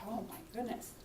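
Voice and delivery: low, hushed inner exclamation; thinking to oneself. Retold or imitated. Walker watching a careening car, quietly startled in thought. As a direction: murmur it softly to yourself. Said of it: "And I thought".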